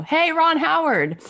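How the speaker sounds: high, slow call out the window; mock yell. Brian calling from the window, high-pitched mock yell. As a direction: call out to someone below. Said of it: "he screamed out the window".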